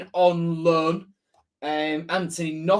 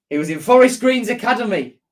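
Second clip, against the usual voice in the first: emphatic correction; 7 semitones higher, 6 dB louder, faster than usual. Real, not acted. Corrects a viewer's accent claim.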